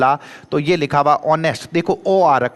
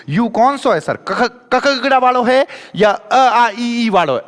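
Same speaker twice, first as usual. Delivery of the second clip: mock student voice; 8 semitones higher than usual. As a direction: ask like a confused pupil. Student asks teacher, raised. (मासूम छात्र की ऊँची आवाज़ में सवाल)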